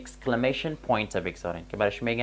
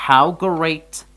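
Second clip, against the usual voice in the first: "how great" called out louder and higher; exclamatory example; 4 semitones higher, 8 dB louder than usual. Shown as a demonstration. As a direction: show the surprise in "how great". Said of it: "در اینجا گفتیم". ("how great" بلند و با تعجب)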